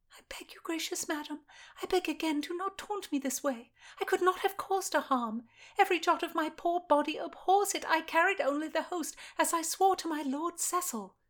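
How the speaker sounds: put-on a young lady in service, desperate and pleading; frightened young lady pleading. Narrator voices Constance pleading.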